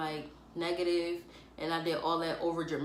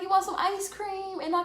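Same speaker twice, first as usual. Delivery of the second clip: high, sweet offer of ice cream; sugary offer to mom before asking; buttering up; 11 semitones higher than usual. Retold or imitated. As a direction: coax mom sweetly to soften her. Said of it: "butter her up real quick".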